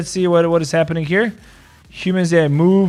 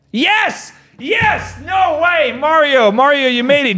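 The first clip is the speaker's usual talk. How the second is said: excited; 8 semitones higher, much slower than usual. Real, not acted. Guest finally connects to stream.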